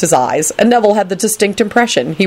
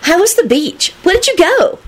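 high, eager questions; bright-eyed high little voice; admiring; 12 semitones higher than usual. A narrator's reading. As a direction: pester with keen interest. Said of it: "Rosie said admiringly".

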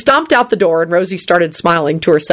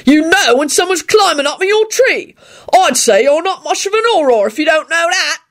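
high-pitched, indignant constable voice; squawky, scornful constable; huffy constable; 10 semitones higher than usual. A narrator's reading. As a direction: lecture him as an affronted officer, pitched up. Constable keeps arguing with Lupin.

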